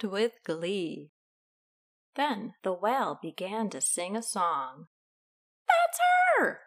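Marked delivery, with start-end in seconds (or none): 5.7-6.6 very high, loud squeal of discovery; high excited storybook crook; excited villain. A narrator's reading. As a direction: pipe up as if you've spotted the target. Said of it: "said Hugh".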